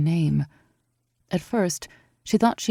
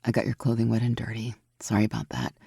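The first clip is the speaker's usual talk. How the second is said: low, soft, assured; downplay it, easy confidence; strong woman, calm; 5 semitones lower, 5 dB quieter than usual. A narrator's reading. Aurora voice.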